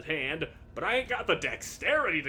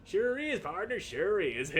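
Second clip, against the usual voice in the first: high twangy Western sidekick; a hint of old prospector; cowboy drawl; 5 semitones higher than usual. Player answering Gabby in a light cowboy voice. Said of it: "Gabby doesn't talk like that".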